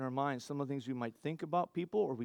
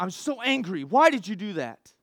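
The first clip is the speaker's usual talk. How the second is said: higher, louder aggrieved outburst; acted anger; 5 semitones higher, 7 dB louder than usual. Preacher voicing a flash of anger at someone, aggrieved outburst. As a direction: let the hurt anger spill out in the moment.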